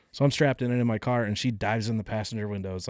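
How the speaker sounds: ordinary delivery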